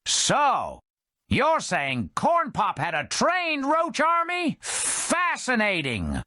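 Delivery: high put-on voice, slow dry ending; dry skeptic. The Red Indian mocking Joe's roach army. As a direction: feign interest, deadpan.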